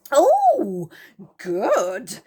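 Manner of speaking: a burst of relief and joy; joyful. Acted. Moonface greeting his rescuers, joyful. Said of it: "said Moonface joyfully".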